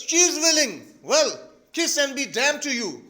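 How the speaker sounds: high, loud acted outburst; exasperated father. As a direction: shout the blessing like a curse.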